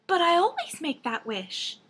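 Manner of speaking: high, pleading princess voice; cartoon princess. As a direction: protest like a naive princess. Princess protests to demon.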